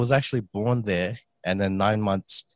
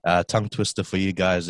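ordinary talk, nothing marked

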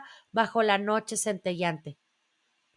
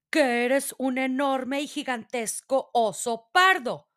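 usual speaking voice

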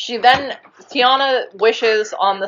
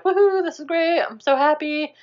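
mock delight; 8 semitones higher than usual. Retelling Charlotte dancing with the prince, mock delight. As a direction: imitate a thrilled princess-to-be.

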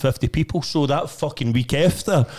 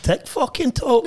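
scornful disbelief; 11 semitones higher, slower than usual. Retold or imitated. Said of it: "I was like".

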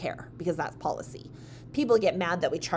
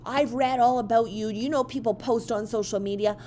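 retold angry rant, higher and louder toward the end; losing it; 4 semitones higher, 4 dB louder than usual. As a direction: imitate a customer working herself up. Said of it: "she starts just losing it".

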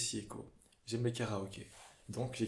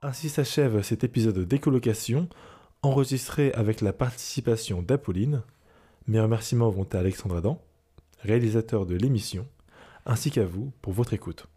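louder, steady announcer read; podcast outro; 11 dB louder than usual. Acted. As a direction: read the sign-off like radio.

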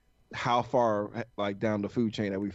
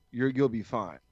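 usual speaking voice

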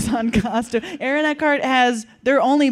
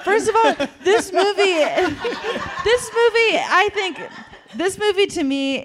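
laughing; 9 semitones higher than usual. Real, not acted. Laughing while defending the movie.

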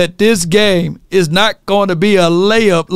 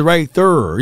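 East Saint Louis "there" as a drawn-out "thurr"; East Saint Louis accent. Shown as a demonstration. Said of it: "how y'all say there".

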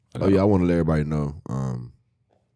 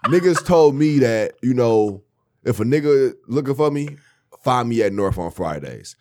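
loud indignant; 7 semitones higher, 7 dB louder than usual. Real, not acted. Protesting his reputation.

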